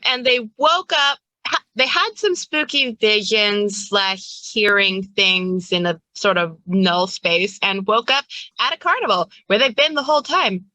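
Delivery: usual speaking voice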